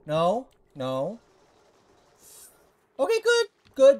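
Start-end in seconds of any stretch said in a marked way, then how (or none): 3.0-4.0 high relieved yelp; a burst of relief; relieved squeal. Real, not acted. Relief after scare.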